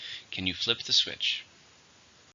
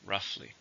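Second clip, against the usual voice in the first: "Roughly" with ROUGH stressed; stress on first syllable; 10 dB quieter than usual. Shown as a demonstration. Teacher models the adverb for learners, "Roughly" stressed on the first syllable. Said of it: "stress on the first".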